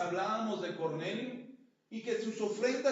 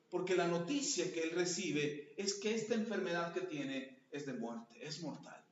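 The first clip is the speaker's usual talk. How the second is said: normal talking voice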